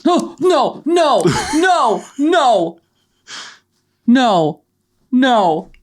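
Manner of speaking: high shouted refusal. Real, not acted. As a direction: yell no in panic. Shouting no at the smell.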